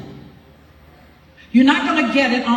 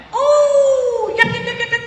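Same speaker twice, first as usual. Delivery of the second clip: much higher, mock-cheerful yep, yep, yep; a high sing-song of mock agreement; sarcastic yep yep; 12 semitones higher than usual. Real, not acted. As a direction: yelp it up high, knowingly ironic. Sarcastic aside about credit cards.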